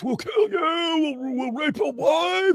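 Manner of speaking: high-pitched mock threatening wail; mock threats. Co-host imitating threats they get, in a high mock wail.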